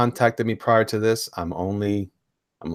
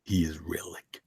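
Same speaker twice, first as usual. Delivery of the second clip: soft, low closing line; Boyette impression; 6 semitones lower, 11 dB quieter, slower than usual. Host ending the tagline in a friend's voice, soft low impression. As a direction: say it hushed and low, deliberately. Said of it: "let me read it in Boyette's voice".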